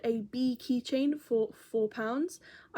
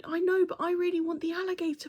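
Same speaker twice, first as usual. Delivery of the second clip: put-on higher child's voice longing; wistful boy; 7 semitones higher, faster than usual. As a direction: sound like a boy who wants it badly. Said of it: "he was going".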